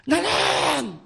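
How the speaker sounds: high, loud impassioned cry; shouting. Retold or imitated. Soldier shouting his testimony to the pastor, impassioned. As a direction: call it out across the hall. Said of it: "이렇게 외칩니다".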